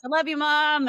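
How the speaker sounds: hammed-up love declaration to the crowd; mock declaration. Teen son shouting to his mom in front of a thousand kids, hammed-up. Son plays along at assembly.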